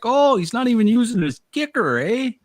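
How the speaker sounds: very high, loud Canadian "eh"; squeaky Canadian accent, amazed at the big boat; over-the-top Canadian accent. Retold or imitated. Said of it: "super Canadian accent".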